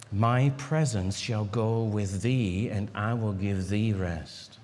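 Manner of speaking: low and slow, reassuring; slow, calm divine voice. Acted. Preacher voicing God to Moses, low, slow and calm. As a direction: speak as God promising rest, unhurried.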